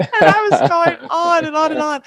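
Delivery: high, laughing through words. Real, not acted. Laughing while telling.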